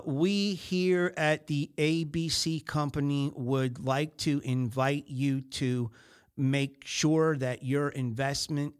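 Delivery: slow, stiff scripted sales pitch; reading a sales script. Retold or imitated. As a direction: mimic a telemarketer reading off a script. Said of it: "she was reading what her company did off a script".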